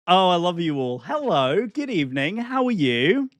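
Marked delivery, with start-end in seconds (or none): none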